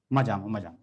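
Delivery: low, contented Gujarati "मजा, मजा"; a low, satisfied "मजा, मजा"; carbon's deep reply. Acted. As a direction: answer low and pleased.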